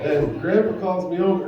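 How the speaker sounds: ordinary delivery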